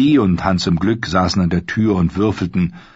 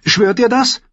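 put-on higher girl's voice asking earnestly; pleading young Arya; 4 dB louder than usual. A narrator's reading. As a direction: beg him for a promise. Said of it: "fragte sie".

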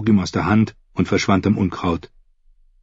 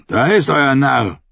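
gruff calling man; 4 semitones higher than usual. A narrator's reading. Crab points out the fool.

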